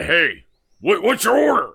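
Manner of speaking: high, loud mock bark; imitated yelling owner. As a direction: yell it like a scary shop owner. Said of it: "He would yell at the help".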